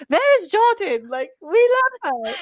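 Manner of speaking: clumsy mock German accent; German accent attempt. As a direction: play an adoring German fan with a bad German accent. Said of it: "I can't do a German accent".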